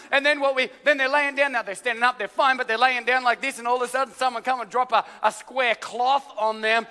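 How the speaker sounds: usual speaking voice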